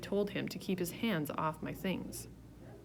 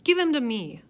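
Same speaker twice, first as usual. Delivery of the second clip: firm demand; 6 semitones higher, 9 dB louder than usual. A narrator's reading. Peter angry at brother.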